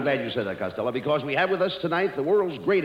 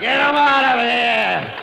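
laughing shout; 6 semitones higher, 5 dB louder, much slower than usual. Acted. [name] breaking up in laughter.

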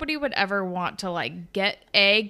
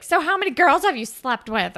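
higher, louder blunt question; blunt Holly J; 6 semitones higher, 7 dB louder than usual. Retold or imitated. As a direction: fire the question point-blank. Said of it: "says, like".

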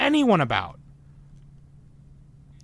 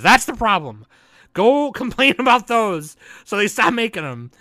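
loud and high, laughing through the rant; real heated yell with a laugh; 6 semitones higher, 7 dB louder than usual. Real, not acted. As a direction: yell it at the fans, half laughing. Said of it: "I didn't mean to yell".

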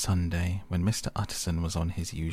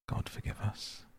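breathed out, barely voiced and much softer; a stunned prayer under the breath; hushed whisper; 8 dB quieter than usual. A narrator's reading. Utterson, shaken after the window, whispers a prayer.

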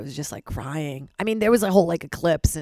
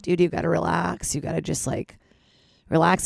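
normal talking voice

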